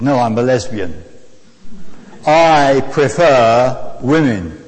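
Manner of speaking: slow, emphatic imitated Eastern European accent. Preacher quotes the passenger's declaration, slow Eastern European accent. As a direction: mimic a Polish woman declaring it slowly. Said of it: "Eastern European accent".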